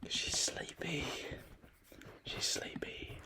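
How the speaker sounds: hushed whisper so the sister keeps sleeping. Real, not acted. Whispering to the camera because his sister sleeps next door. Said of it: "so we gotta be quiet".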